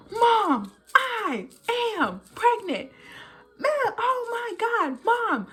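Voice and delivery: high, panicked texting voice; retelling frantic texts to mom, high and panicky; freaking out. Retold or imitated. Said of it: "I sent those messages just, like, freaking out".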